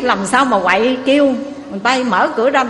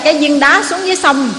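ordinary talk

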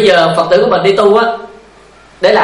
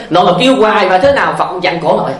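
ordinary delivery

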